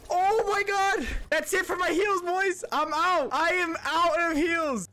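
high, distressed outburst; dismayed exclaiming. Real, not acted. Player telling the boys the heals are gone, high dismay.

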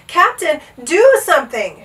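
loud, high urgent shout. A narrator's reading. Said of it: "shouted Bartholomew".

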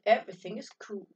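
cool. Acted. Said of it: "He says".

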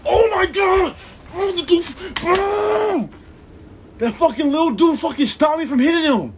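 very high, loud raging scream; real furious screaming; screaming rage. Real, not acted. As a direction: scream out the outrage.

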